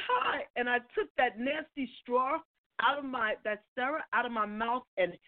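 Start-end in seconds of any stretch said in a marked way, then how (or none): 0.1-0.4 high, breathless little hi to an idol; starstruck hi. Retold or imitated. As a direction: squeak out a starstruck hello. Starstruck fan greeting her idol.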